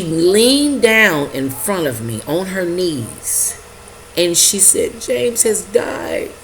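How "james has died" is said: higher, drawn-out retold cry; imitating a distraught woman. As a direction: slowly voice her tearful news, without real sobs. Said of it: "And she was crying".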